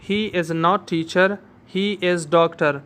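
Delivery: no, he is not teacher, spelled out word by word; slow dictation. Shown as a demonstration. Teacher dictating an example sentence to students, very slow. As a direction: say it slowly so students can write. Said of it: "फिर यहाँ पे आप देखें".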